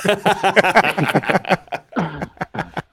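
a long, high giggle; embarrassed laughter; sheepish laugh. Real, not acted. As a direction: laugh at his own blunder. Guest apologises after radio joke.

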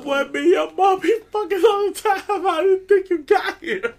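very high, loud comic dad yell; retold exasperated shout. Dad setting his embarrassed son straight, mock-outraged shouting.

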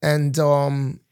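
put-on sustained amateur London accent; playful fake London accent; mock London accent.

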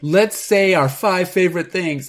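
giddy couple. Retold or imitated. Voicing the couple.

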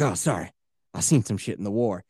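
low and weary, like a man haunted by war; troubled veteran. Retold or imitated. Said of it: "doing accents".

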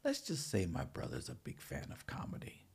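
hushed secretive whisper. Retold or imitated. Retold reply from a stranger.

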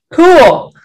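high, loud burst of approval; delighted exclamation. Retold or imitated. Filmmaker retelling his reaction to getting a yes, delighted. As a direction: exclaim it loudly, pitched well up.

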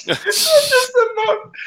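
high, slow, laughing. Real, not acted. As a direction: squeeze it out through laughter. Laughing at the mix-up.

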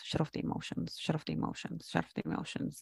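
whispered over and over, fading into a chant. Shown as a demonstration. Telling herself to go numb, in a soft repeated whisper. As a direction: whisper it to yourself like a mantra you're obeying.

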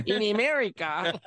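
put-on high, comic Russian accent; mock Russian accent. Actor mocking his failed Russian, hammy Russian accent. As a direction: overdo a bad Russian accent for laughs. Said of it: "I couldn't do my Ru".

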